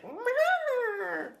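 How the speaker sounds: high, stretched bird screech; hawk cry imitation. Reader copies the hawk for viewers, hawk cry imitation. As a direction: copy the young hawk's long cry. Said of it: "he's like".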